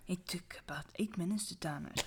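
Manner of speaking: soft, quick spooky whisper; dramatic whisper. Acted. Rereading the line for friends, whispered and quick. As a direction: hush it like a campfire tale. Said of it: "Dramatic voice again".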